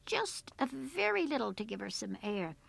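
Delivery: ordinary delivery, nothing marked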